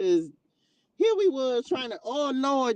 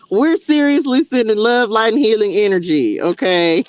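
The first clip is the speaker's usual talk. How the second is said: much louder, pressing the blessing home; emphatic; 13 dB louder than usual. Real, not acted. Host answering a troll on her livestream, emphatic and loud. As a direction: lay it on firm and loud.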